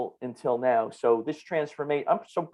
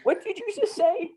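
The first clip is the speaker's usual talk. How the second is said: much higher, incredulous put-on voice; mock disbelief. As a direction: play stunned on the phone, pitched way up. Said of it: "I'm on the phone with her and I go".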